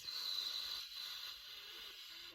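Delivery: a long unvoiced exhale, as if into a breathalyzer; empty your lungs in one long blow; a drawn-out demo breath with no voice; long breath blown out. Shown as a demonstration. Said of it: "and you like this".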